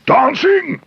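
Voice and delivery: a high, incredulous echo of the word; outraged disbelief. Acted. Neighbour catches bear spying, repeats his word in outrage. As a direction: spit it back in disbelief.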